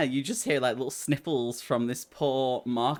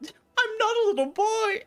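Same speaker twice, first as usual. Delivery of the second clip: put-on high, tearful boy's protest; crying boy; 3 dB louder than usual. Boy leader denies being a child, high and tearful. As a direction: sob it out like a hurt child. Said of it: "cries even harder".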